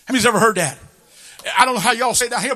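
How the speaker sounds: ordinary talk, nothing marked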